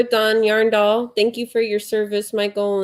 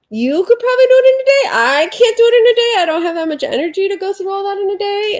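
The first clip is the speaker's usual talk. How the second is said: much higher, faster imitating voice; high put-on voice; 11 semitones higher, 5 dB louder than usual. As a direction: squeak it out like a mock friend. Quoting friend in high voice.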